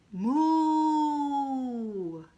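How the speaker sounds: very slow, long cow moo; drawn-out moo. Acted. Reading to kids, long slow cow moo. Dog moos.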